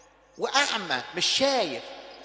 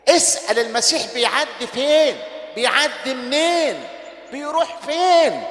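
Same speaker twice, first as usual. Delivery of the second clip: loud, urgent exhortation; fiery preaching; 2 semitones higher, 4 dB louder than usual. Real, not acted. Preacher urging listeners to seek Christ, loud and forceful. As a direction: drive the congregation on.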